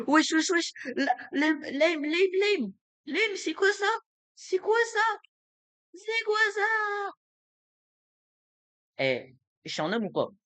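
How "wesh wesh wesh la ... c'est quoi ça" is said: squeaky panic. Real, not acted. Spotting enemy, laughing panic.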